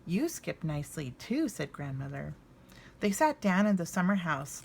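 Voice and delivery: lively storybook narration, voicing each bear; reading to children. A narrator's reading. Reading Little Bear's Visit to viewers, warm storybook narration. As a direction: read it aloud like a picture book at bedtime. Reads a children's book aloud.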